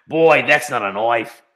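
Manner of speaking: broad Australian movie parody; Australian accent. Acted. Riffing on Australian Kratos, broad Australian accent. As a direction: put on a broad Aussie accent. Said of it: "if Kratos was Australian".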